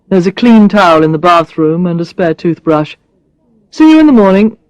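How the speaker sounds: a hint of a blunt man, teasing; Brad's voice. Brad teasing Alex about her looks, lightly voiced man.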